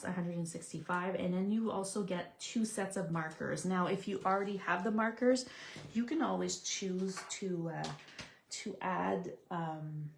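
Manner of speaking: ordinary talk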